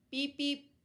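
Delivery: high, loud beeping; truck horn beeps. Acted. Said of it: "said Blue".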